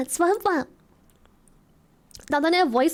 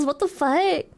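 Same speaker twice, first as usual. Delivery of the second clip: ordinary talk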